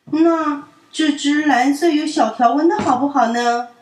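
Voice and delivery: high, loud coaxing question; coaxing child. A narrator's reading. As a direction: try again patiently. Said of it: "淘淘问". (耐心地哄)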